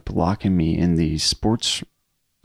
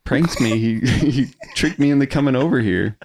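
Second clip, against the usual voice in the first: raised, earnest plea; higher pleading explanation; 5 semitones higher than usual. Retold or imitated. Student explaining the prank to mad teacher, higher plea. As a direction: insist it was a prank.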